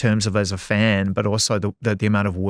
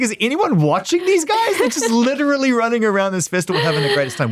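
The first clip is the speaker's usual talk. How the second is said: excited; 11 semitones higher, 3 dB louder than usual. Retold or imitated. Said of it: "I'm like".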